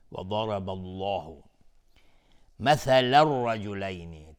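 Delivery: slow, melodic, drawn-out chant; Quran recitation. Shown as a demonstration. Reciter chanting the whole verse slowly, melodic and devout. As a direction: let each phrase ring out, slow and devout.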